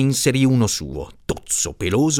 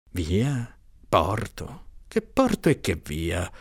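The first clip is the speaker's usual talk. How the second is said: frowning puzzlement; 5 dB quieter, slower than usual. Acted. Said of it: "domandò aggrondato".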